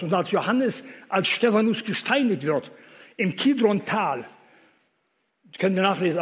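loud, high, impassioned sermon voice; thundering pulpit preaching; fiery preaching. Acted. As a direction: preach it out to the whole hall. Preaches at full force.

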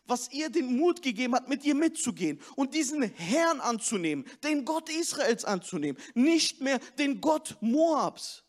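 rousing sermon voice, pushed and driving; fervent preaching. Acted. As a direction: preach it to the whole hall, building. Preaching at full force.

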